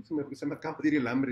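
fast, loud, rattled; flustered rush. Acted. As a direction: blurt it out in a hurry. Brother says twice, first hurried.

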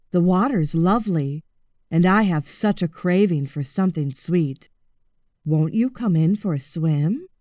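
low, sly crocodile calling from the water; a lurking crocodile luring him in, low; low-voiced crocodile.

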